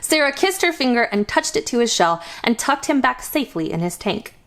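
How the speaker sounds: plain reading voice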